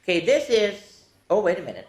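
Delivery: usual speaking voice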